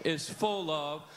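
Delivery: very slow, even chant of the verse; drawn-out unison recital; slow group recitation. Shown as a demonstration. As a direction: lead the room in saying it together. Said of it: "How about one more time?".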